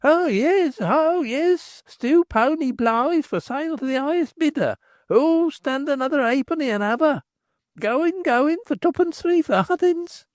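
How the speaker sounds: high mock crier chant; boys yelling. Boys mocking the crier down the street, yelling high.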